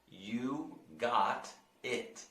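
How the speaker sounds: "You got it." very slow, got sounding like god; slow repeat model. Shown as a demonstration. Teacher drills the phrase slowly for the class. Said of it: "Try to, try to repeat that".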